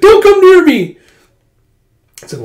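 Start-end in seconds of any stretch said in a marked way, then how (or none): 0.0-1.0 very high, very loud panicked shriek; panicked yell. Retold or imitated. Princess screaming at Huck to keep away.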